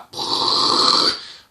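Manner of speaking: loud breathy rushing noise; a breathy whoosh of fire; imitated whoosh. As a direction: make the sound of everything bursting into flame. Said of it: "everything went like".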